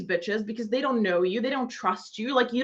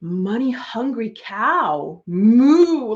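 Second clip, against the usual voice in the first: slow, then a loud mock moo; self-mocking cow; 5 dB louder, much slower than usual. Mocking herself as a money-hungry cow, with a loud moo. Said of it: "And I'm just".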